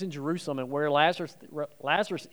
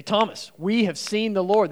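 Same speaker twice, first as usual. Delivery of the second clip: higher, louder, slow and emphatic; excited announcement; 6 semitones higher, 5 dB louder than usual. Acted. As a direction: burst out with the news. Disciples announce resurrection to Thomas.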